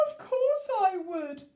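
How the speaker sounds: high, loud, slow anguished cry; the king answers Miss Sunshine, crying out. Acted. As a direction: cry it out desperately. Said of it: "cried the king".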